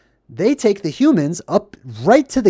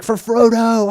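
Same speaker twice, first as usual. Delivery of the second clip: battle cry; 6 semitones higher, 4 dB louder than usual. Retold or imitated. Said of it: "He's like".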